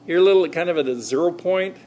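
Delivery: ordinary talk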